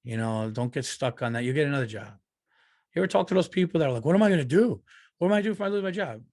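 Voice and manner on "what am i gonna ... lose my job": mock panic. Panicky people quoted.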